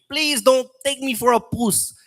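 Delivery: high, slow South African impression; sing-song mock South African; South African accent. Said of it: "If you hear a South African".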